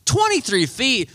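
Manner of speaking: can't believe the number; slow stunned echo of the guide; shocked disbelief. Retold or imitated. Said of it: "I was like".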